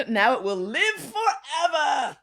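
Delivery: slow, high, loud melodramatic wail; mock-dramatic doom. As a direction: draw it out in hammed-up horror. Comic horror at post's permanence.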